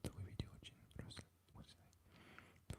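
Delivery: halting whispered reading. Real, not acted. Sounding out a viewer's Russian comment, whispered. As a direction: whisper it very softly, feeling out the words.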